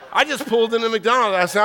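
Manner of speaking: normal talking voice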